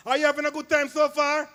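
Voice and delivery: ordinary talk, nothing marked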